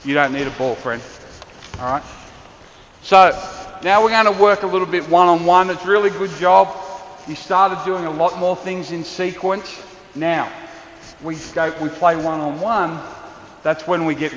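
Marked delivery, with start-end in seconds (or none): none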